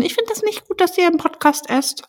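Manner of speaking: high mock-indignant listener voice; mock complaining listener. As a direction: play a prissy listener complaining. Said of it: "Freu mich jetzt schon auf die Nachrichten".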